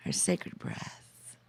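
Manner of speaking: slow, hushed whisper; whispered close of a meditation; meditative whisper. Real, not acted. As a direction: let it fade into a slow whisper.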